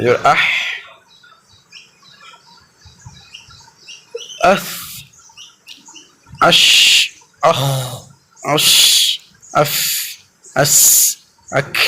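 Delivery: each letter closed with a thin stream of air; breathy sakin letters. Shown as a demonstration. Teacher demonstrating hams letters with a thin breath. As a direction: let a light hiss of breath follow each আস আশ আফ আক. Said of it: "এইরকম পাতলা".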